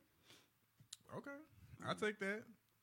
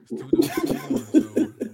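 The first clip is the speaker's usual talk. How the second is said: loud laughing words; 10 semitones higher, 16 dB louder than usual. Real, not acted. Laughing after joke.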